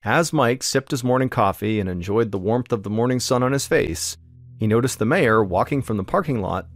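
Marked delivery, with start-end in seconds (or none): none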